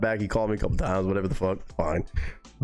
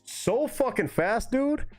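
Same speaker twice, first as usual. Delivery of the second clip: excited squeak; 12 semitones higher, much slower than usual. Real, not acted. Excited about fast fence jump.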